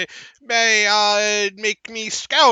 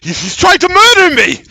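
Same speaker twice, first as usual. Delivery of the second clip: high, loud frightened cry; panicked shout; 9 semitones higher, 9 dB louder than usual. Acted. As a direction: yell it in a panic. NPC panics at threat.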